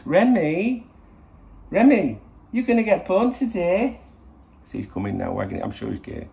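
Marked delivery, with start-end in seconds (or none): 0.0-4.0 high, loud, sing-song dog voice; funny voice. Shown as a demonstration. Owner calling his dog in a high, excited funny voice. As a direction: squeal it to get the dog excited. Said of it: "If I do it in a funny voice".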